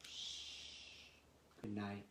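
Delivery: soft sleepy goodnight dying away; fading goodnight. Acted. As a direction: tuck them in with a hush. Bedtime hush ending.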